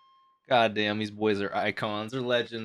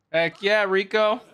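higher, brighter cheer for her; 8 semitones higher, 3 dB louder than usual. Real, not acted. Cheering for the coach.

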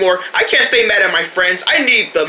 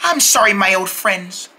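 ordinary talk

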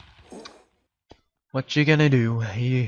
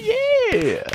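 very high, loud, drawn-out yeah; squealed cheer; 6 dB louder than usual. Real, not acted. Player celebrates taking the forest, high squealed cheer. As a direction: squeal it out in triumph.

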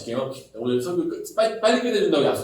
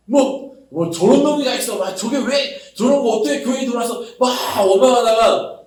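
higher, louder scornful grumble; mock scorn; 7 semitones higher, 4 dB louder than usual. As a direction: sneer at the newcomer. 못마땅한 교인 흉내. (높고 큰 소리로 비웃는 흉내)

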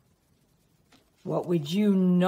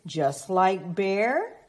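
ordinary delivery

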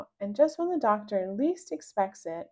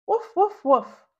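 high loud woofs; puppy barking; 9 semitones higher, 7 dB louder, slower than usual. Acted. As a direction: bark cheerfully like a puppy. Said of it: "I say".

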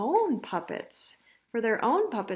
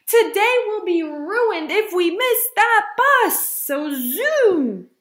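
high, loud, drawn-out alarm; urgent shout; 8 semitones higher, 11 dB louder, slower than usual. Acted. Mother rushing Ava for the bus, shouting urgently. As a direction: belt it out in a storybook panic.

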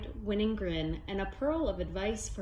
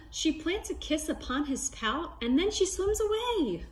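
higher, amazed storytelling; surprised; 7 semitones higher than usual. A narrator's reading. Reading to kids, amazed at the surprise kiss. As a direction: gasp and tell it with wonder.

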